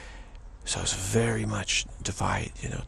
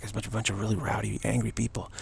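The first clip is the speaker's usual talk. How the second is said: normal talking voice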